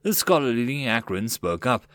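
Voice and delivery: ordinary delivery, nothing marked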